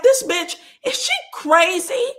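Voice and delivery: high, loud, laughing disbelief; mock incredulous. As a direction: imitate someone flabbergasted, cracking up. Acts out partner's wide-eyed disbelief.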